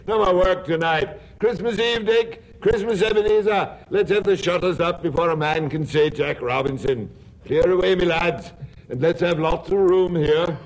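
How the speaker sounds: rich, jolly calling-out voice; jovial voice. Acted. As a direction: call out big and hearty. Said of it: "jovial voice".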